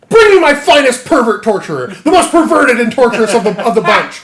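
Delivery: loud high royal command; hammed-up king's demand; king bellowing orders. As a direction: bark for the torturer.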